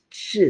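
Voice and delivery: ch made softly with the tongue up as for L; tentative ch, tongue against the palate; softened ch demonstrated. Shown as a demonstration. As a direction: try a soft, palatal ch. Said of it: "you're actually saying a ch".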